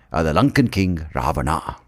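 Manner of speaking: ordinary delivery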